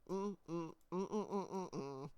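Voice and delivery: a string of short yummy hums; savouring "mm" hums. Pretend. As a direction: hum "mm" repeatedly as if savouring food. Savouring the rice pudding aloud.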